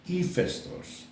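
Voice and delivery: "Hephaestus." said ee-FES-tos, slow and high; Greek pronunciation. Shown as a demonstration. Teacher showing viewers the proper Greek name, slow ee-FES-tos. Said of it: "the proper pronunciation is".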